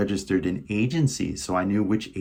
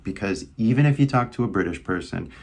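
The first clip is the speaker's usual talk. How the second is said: ordinary delivery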